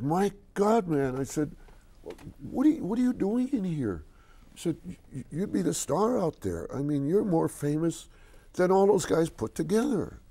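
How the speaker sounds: high, eager, breathless astonishment; excited disbelief. Retold or imitated. A fan telling his hero he's famous, high excited gush. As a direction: gush at him in awe.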